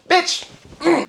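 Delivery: high-pitched comic snap and grunt; mock angry outburst. Acting out a pimp's backhand, mock angry outburst. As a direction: spit the insult high, then grunt.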